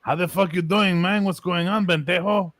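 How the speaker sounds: California Chicano accent. Shown as a demonstration. Demonstrating California accents to friends, Chicano accent. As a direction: greet a buddy in a Chicano accent. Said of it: "only three accents in all of California".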